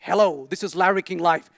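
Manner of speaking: a hint of a TV host greeting the audience; Larry King. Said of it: "waking up to Larry King Live".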